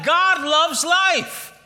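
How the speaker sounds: slow, loud proclamation; preaching shout. Real, not acted. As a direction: preach it out slowly, full force.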